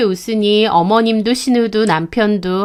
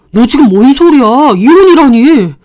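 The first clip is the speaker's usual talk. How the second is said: loud, higher, stunned outburst; shocked husband; 4 semitones higher, 9 dB louder than usual. Acted. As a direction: react to the word divorce. (이혼 말에 놀라 소리치는 남편)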